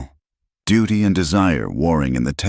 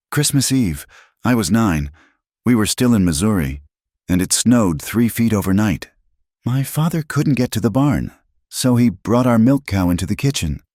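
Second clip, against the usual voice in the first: lightly higher woman, remembering fondly; a hint of a nostalgic young woman; woman's voice, fond memory; 6 semitones higher, 3 dB louder than usual. A narrator's reading.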